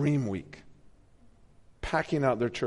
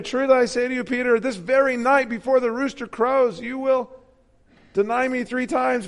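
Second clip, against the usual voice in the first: high, raised solemn warning; solemn Jesus; 10 semitones higher, 6 dB louder than usual. Acted. Jesus warning Peter, lightly voiced and solemn. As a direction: warn him gravely.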